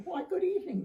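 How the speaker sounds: put-on high, bright child greeting; delighted girl. A narrator's reading. Small girl at the door greeting the dog, high and bright.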